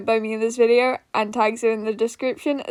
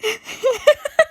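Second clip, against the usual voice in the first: high crying laugh; 12 semitones higher than usual. Real, not acted. Laughs high after attempts.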